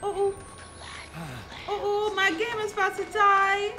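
high panicked cries. Real, not acted. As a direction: panic as the character nears death. Game character about to die.

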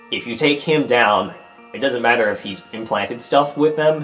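ordinary talk

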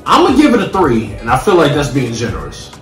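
ordinary delivery

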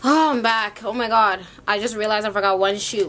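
ordinary talk, nothing marked

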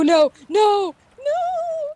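rising high loud noes; dismayed cries. Real, not acted. Player seeing her teammate die, rising cries of dismay. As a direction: protest in growing dismay.

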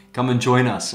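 normal talking voice

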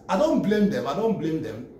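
ordinary talk, nothing marked

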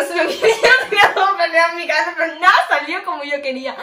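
loud laughing while telling. Real, not acted. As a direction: laugh through the story. Se ríe contando la historia. (riendo a carcajadas al contarlo)

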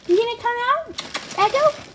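high coaxing pet voice. Real, not acted. Owner coaxing her rat out of hiding, high baby talk. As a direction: coax her out, sweet and high.

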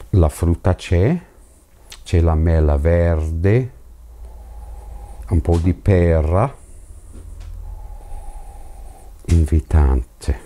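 speaking into a glass; talking while nosing whisky; glass held to mouth. Real, not acted. Seen 0: Speaks while nosing; glass intermittently at mouth, otherwise at chin.

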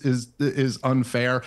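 ordinary talk

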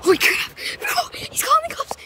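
high frightened whisper; panicked whisper. Real, not acted. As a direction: hiss it in panic. Owner calls the police.

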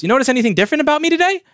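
high, quick, sing-song question; playful asking. Retold or imitated. Coworker teasing him as he walks in, playful high question.

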